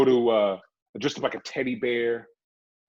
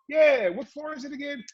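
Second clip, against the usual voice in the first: higher, breezy reply; his younger self, voiced higher; casual reply; 12 semitones higher than usual. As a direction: answer casually like a regular. Him answering the guard casually.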